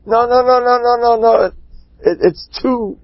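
high, rapid-fire repeated protests; agitated refusal and begging. Acted. Rav refusing the curious husband, high insistent back-and-forth. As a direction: trade urgent refusals and pleas, pitch up.

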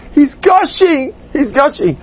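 put-on high, slow child's wail; milk it like a little boy; dramatic kid. Kid dramatically reports bleeding.

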